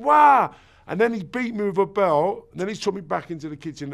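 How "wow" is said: shocked wow. Retold or imitated. Shock at hand burning.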